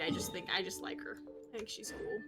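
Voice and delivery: ordinary talk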